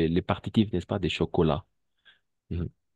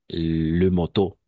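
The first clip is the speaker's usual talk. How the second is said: slow "moto" with the stress on its last syllable; final-syllable stress; 4 dB louder, much slower than usual. Shown as a demonstration. Teacher giving an example, "moto" slow and stressed on the end. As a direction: draw out "moto", landing on the final TO. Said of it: "siempre va a ir al final".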